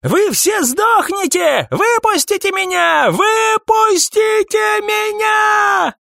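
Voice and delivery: high, drawn-out frantic scream. A narrator's reading. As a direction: shriek it out long and loud, demanding release. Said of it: "кричал Сайрус". (истошный протяжный крик)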